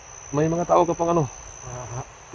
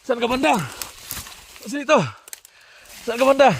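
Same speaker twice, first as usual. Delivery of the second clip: high urgent shout across the field; calling out; 9 semitones higher than usual. Real, not acted. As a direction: yell the name to find him. Searching for wounded teammate.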